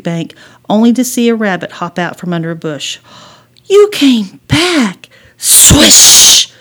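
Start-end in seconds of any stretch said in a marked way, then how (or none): 3.7-5.1 high, slow gasp of delight; delighted surprise. Acted. Girl seeing the rabbit return, delighted. 5.4-6.5 loud hissed swish; whooshing sound effect. A narrator's reading. Slingshot shot whizzing by a girl, loud whoosh. Narrator imitates a flying stone.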